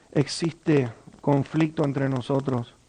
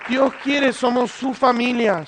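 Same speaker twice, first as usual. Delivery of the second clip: higher and louder, slow and fervent; a preacher's loud, slow climax; fervent preaching cry; 6 semitones higher, 3 dB louder, slower than usual. Real, not acted. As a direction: cry it out to the congregation.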